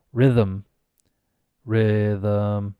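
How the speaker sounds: rhythm with vocal cords humming through th; voiced th. Shown as a demonstration. Teacher explains the cords never stop, vocalized th in rhythm. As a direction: keep the voice running through rhythm. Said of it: "I don't stop my vocal cords".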